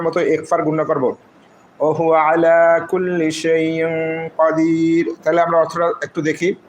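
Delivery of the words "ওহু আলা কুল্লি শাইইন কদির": slow, drawn-out tajweed chant; Quran recitation. Acted. Teacher chants the verse slowly for students after spelling it. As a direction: recite it slowly and melodically, like the imam.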